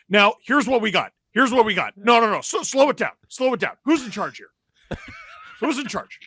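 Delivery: high, exasperated commentator rant; Sid Seixeiro. Acted. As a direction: demand control in short, repeated high bursts. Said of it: "Can you do your Sid Seixeiro impression?".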